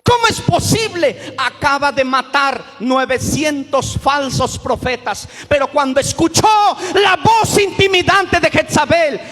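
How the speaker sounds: thundering pulpit delivery; fiery preaching. Acted. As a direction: preach it out, building to a shout. Preacher builds to full force.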